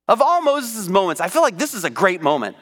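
usual speaking voice

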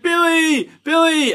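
high, loud call for Billy; yelling for help. Retold or imitated. Alice calling Billy from her dorm, high loud yell. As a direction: shout his name down the hall, scared. She yells out the door.

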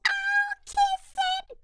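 a sweet-on-him schoolgirl; high girly coo; girl crying out. Acted. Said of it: "cried Froggalina".

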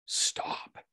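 hushed, voiceless "stop"; inner plea. Retold or imitated. His logic brain telling himself to stop, hushed. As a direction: say it under the breath, to yourself.

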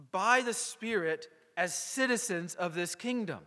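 ordinary delivery

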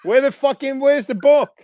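high-pitched, baffled retelling of a protest; mock exasperation. Actor on empty green screen, exasperated and high. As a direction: protest as if hunting for something invisible.

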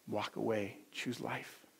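very soft, low plea; Father's gentle urging. Acted. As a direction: invite them quietly and earnestly. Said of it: "the voice of your Father saying".

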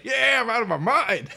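high, loud, laughing madness; mock crazed shout. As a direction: yell it like you've totally lost it. Playing a crazed attacker.

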